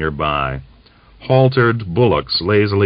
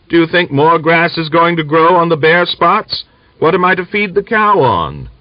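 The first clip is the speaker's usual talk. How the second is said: pleading; 10 semitones higher, 5 dB louder than usual. A narrator's reading. Griagor pleads with old woman.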